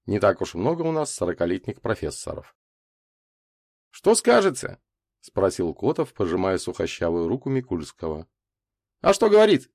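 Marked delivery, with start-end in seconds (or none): 3.9-4.8 higher, louder character question. A narrator's reading. Котов asks his colleague, questioning. 9.0-9.7 interrupting. A narrator's reading. Славка breaks into the men's talk, interrupting. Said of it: "перебил Славка".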